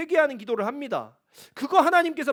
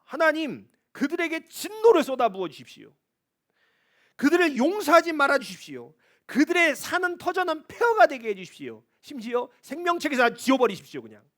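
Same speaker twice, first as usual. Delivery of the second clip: raised, louder angry plea to God; David's anger; 6 semitones higher, 5 dB louder than usual. Retold or imitated. Pastor voices David crying out to God in anger. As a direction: pour out David's fury, higher and louder. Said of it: "다윗의 분노가 막 느껴집니다".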